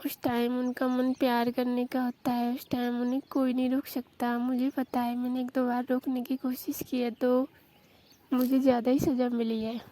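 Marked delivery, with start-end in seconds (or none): none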